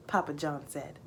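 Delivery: plain reading voice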